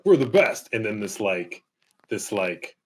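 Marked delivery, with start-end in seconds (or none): 0.0-0.7 cocky boast. Retold or imitated. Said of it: "the United States is like".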